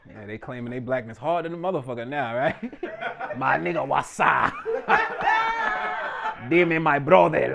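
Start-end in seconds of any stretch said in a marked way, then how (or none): 3.4-4.5 put-on laughing Dominican-accented call; laughing shout of a greeting; mock Dominican greeting. 6.5-7.5 put-on slow Dominican-accented greeting; slow friendly Spanish-tinged greeting; mock Dominican.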